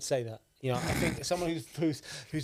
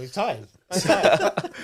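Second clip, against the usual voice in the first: laughing through the words, louder on the repeat; amused teasing; 4 semitones higher, 7 dB louder, much slower than usual. Real, not acted. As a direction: tease him while cracking up. Co-host cracks up teasing him.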